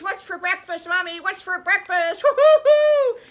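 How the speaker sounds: put-on high, loud, boisterous child voice; little pigs bursting with energy; excited piglets. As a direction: shout eagerly for breakfast, ending in a whoop. Piglets race down to breakfast.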